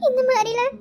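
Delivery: ordinary delivery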